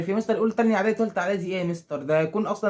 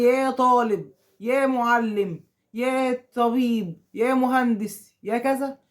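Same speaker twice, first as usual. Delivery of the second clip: each call drawn out slowly and a bit higher; 4 semitones higher, much slower than usual. Shown as a demonstration. Teacher showing pupils the vocative, drawn out slowly. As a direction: spell out every call slowly for the class. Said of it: "لما يجي حد مثلًا يقول لي".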